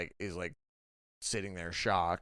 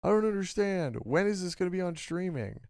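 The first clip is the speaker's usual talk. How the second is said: much higher, put-on complaining voice; mock baffled commenter; 10 semitones higher than usual. Host mimics Reddit posters confused about streaming, high mock bafflement. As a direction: imitate a clueless fan pleading for answers. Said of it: "Just, like".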